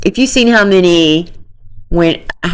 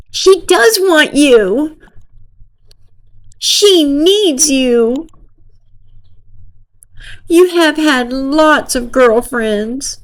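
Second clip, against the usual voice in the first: higher, slow, hammed-up girl voice; mock girly pleading; 10 semitones higher, slower than usual. Girl assuring Nick she wants him, slow mock girly voice. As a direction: drag it out like a melodramatic teen.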